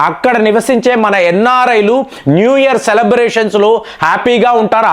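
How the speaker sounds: normal talking voice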